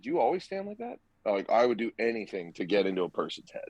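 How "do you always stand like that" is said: high, teasing taunt; taunting question. Retold or imitated. Catcher taunting the batter, high teasing voice. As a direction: needle him with a high sing-song. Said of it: "I was like".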